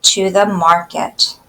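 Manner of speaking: put-on slow, clear poem reading for kids; slow read-aloud. Teacher reading a poem slowly to children.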